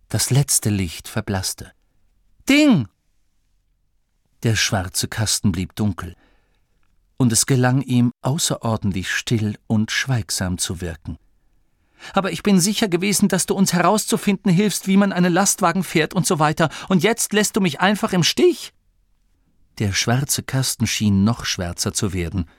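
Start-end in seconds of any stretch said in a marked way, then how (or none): 2.5-2.9 loud, high cry falling away; urgent call. Acted. Ruft das verstummte Ding. 12.1-18.7 high, rushed protest; indignant reproach. Acted. Masklin fühlt sich im Stich gelassen.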